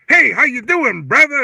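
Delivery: loud, high mock-chummy hail; hammed-up customer trying slang; imitated slang greeting. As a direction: overdo the brother talk, big and loud. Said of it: "trying to use all this slang".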